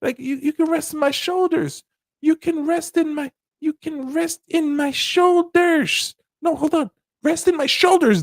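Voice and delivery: hammed-up player comforting; mock comforting player. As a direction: insist she rest on you. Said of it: "player voice continued".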